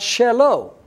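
shelo as sheh-LOH, deliberately pronounced; Hebrew shelo. Shown as a demonstration. Preacher repeating the word for his, deliberate shelo. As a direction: say shelo with short e and long o. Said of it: "His is pronounced shelo".

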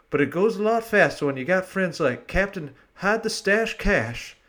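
hosting in a hammed-up Southern accent. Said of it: "the last of my Southern accent".

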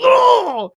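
high strangled gasp of a seizing heart; hammed-up cardiac "Oh!"; mock heart attack cry. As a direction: yelp like your chest just gave out. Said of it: "he has, like, a heart attack".

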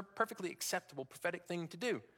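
normal talking voice

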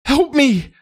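very high, shrill cry for help; screamed plea. A narrator's reading. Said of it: "Miguel screamed".

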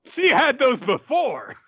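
high, drawn-out indignant cry; hammed-up indignant yelp; mock outrage. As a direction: protest it in exaggerated outrage. Mock outrage in fandom quarrel.

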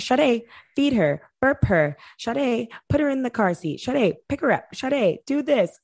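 high, insistent cousin giving orders; bossy cousin. Retold or imitated. Cousin ordering her around, high and insistent. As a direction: rattle off orders like a bossy mom.

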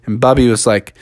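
ordinary delivery

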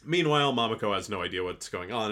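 usual speaking voice

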